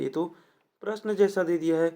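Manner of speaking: ordinary talk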